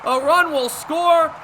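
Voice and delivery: ordinary talk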